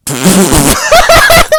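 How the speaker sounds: loud real laugh at a corny line; just crack up; burst of laughter. Real, not acted.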